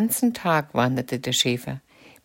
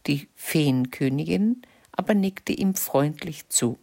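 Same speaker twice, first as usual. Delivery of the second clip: usual speaking voice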